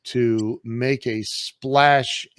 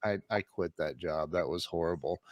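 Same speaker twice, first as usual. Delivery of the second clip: much softer and lower, stammering it out; sheepish confession; 7 semitones lower, 11 dB quieter than usual. Retold or imitated. Telling his wife he quit the job, soft and sheepish. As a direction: admit it meekly, bracing for trouble. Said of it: "And I said".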